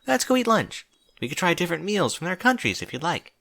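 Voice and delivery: high-pitched character voice, lightly encouraging; encouraging friend. Acted. Twilight cheers up Celestia.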